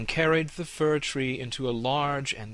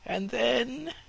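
high, breathless pleading fir tree voice; trembling eager young tree. Acted. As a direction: beg to hear more, trembling. Fir trembling asks more.

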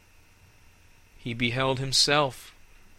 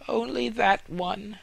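proud fir tree; 10 semitones higher than usual. Acted. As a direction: speak as the vain young fir.

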